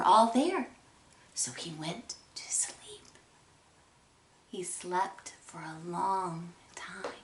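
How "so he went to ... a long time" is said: near-whispered bedtime hush. A narrator's reading. Narrator tells children the peddler falls asleep, hushed.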